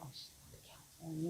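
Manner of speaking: hushed whisper behind someone's back; gossipy whisper. Acted. Imagined gossips talking behind a client's back, whispering. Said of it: "people whispering behind your back".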